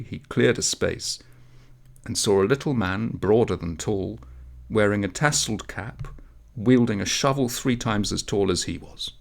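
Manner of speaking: ordinary delivery, nothing marked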